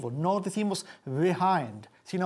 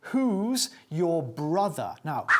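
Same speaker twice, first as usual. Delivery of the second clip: ordinary talk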